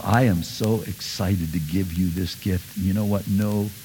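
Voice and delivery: soft, tender offering, fading to a whisper; tender gift giver, soft to a hush; loving giver. Retold or imitated. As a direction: give it gently with love. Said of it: "an expression of love".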